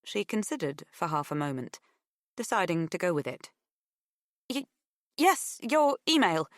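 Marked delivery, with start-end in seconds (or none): 4.5-6.5 louder, high, hesitant agreement; stumble, then play along brightly; faltering yes, pitched well up; stammered bluff. A narrator's reading.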